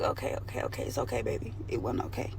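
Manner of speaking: soft, half-whispered comfort; quiet mock reassurance to the crying boyfriend; hushed soothing. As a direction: murmur it like calming a crying partner.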